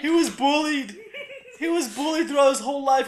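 high, hammed-up crying plea; mock-tearful protest. Dad defending poor bullied Rudolph, mock-tearful protest. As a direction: plead as if sobbing for a victim.

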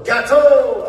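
hammed-up French accent, high; snooty French waiter correcting her. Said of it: "He went".

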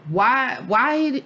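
exasperated why. Real, not acted.

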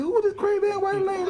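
high, fast disbelieving squeal; high-pitched baffled outburst; incredulous. Retold or imitated. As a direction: shriek it in disbelief. Incredulous retold question.

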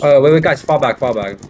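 loud urgent call to retreat; shouting orders over battle. Real, not acted. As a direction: bark the retreat over gunfire. Commanding team in firefight.

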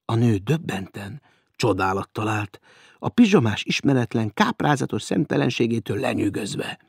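usual speaking voice

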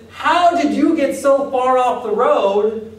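laughing mock disbelief; incredulous Paul. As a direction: ask how they strayed so fast. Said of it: "And he says".

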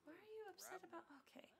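quick hushed whisper. Real, not acted. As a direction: whisper fast so nobody wakes. Hushed talk near sleeping baby.

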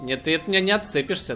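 ordinary talk, nothing marked

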